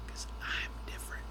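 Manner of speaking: whispered, very soft and quick; barely audible, secretive whisper; hushed aside. Real, not acted. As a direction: drop to a confiding whisper. Mock-dramatic aside on his special.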